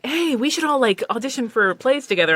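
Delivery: light mock-eager invitation; joking suggestion. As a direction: pitch it up like a jokey proposal. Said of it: "Colleen jokingly was like".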